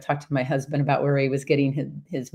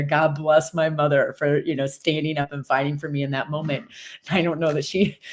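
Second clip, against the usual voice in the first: ordinary talk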